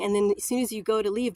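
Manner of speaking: ordinary talk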